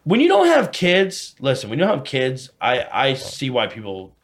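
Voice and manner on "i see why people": usual speaking voice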